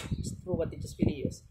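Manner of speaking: slow whispered formula; whispered incantation. Acted. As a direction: breathe the spell under your breath. Whispered second take. (pabulong na orasyon)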